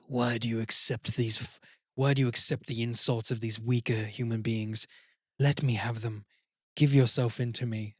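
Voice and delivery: low, quiet, menacing tempting voice; demon voice in his head. Acted. Demon in his head tempts the downtrodden hero, low and menacing. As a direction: coax him darkly from inside his head. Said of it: "this voice in his head putting him down".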